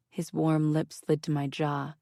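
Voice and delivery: plain reading voice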